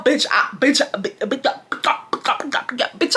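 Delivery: hammed-up panicked stutter, ending high; startled stammering. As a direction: sputter the word over and over, then squeal. Acts out own startled stammering.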